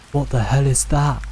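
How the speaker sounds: plain reading voice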